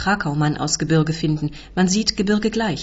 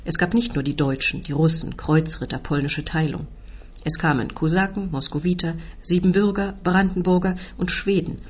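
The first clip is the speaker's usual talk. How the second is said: ordinary delivery, nothing marked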